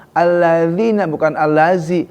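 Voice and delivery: ordinary talk, nothing marked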